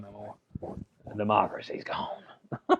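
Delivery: hushed conspiratorial whisper. Real, not acted. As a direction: breathe it like a secret. Wry aside about Hong Kong.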